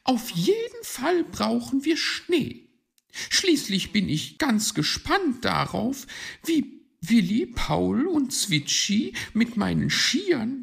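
high, loud lady magpie voice; excited high-pitched bird lady; eager magpie. Acted. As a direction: announce it high and keenly.

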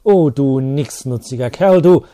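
loud, slow thundering insult. Acted. Priest thundering at the teacher, furious. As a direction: roar the insult down at him. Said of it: "donnerte Sepp".

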